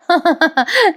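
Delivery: high, short giggle; amused laugh. Real, not acted. Narrator amused by soaked brothers, high giggle. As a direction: giggle at the mishap.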